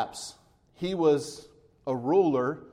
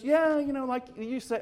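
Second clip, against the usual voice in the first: airy, higher offhand yeah; nonchalant; 9 semitones higher than usual. Retold or imitated. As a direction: shrug the answer off casually. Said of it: "leisurely or nonchalantly answers".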